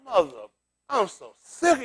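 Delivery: mock exhausted zoo animal grumbling; weary zoo animal. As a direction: voice a fed-up caged gorilla, dragging. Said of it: "that line look like".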